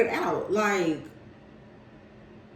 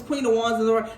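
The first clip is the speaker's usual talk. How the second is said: usual speaking voice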